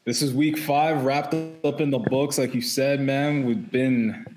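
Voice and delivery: usual speaking voice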